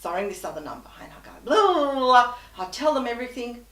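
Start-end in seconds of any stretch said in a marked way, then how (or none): 1.5-2.4 loud exasperated blah. Retold or imitated. Venting story to agent.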